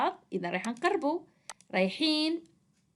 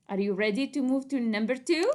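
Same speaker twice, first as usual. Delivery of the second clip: normal talking voice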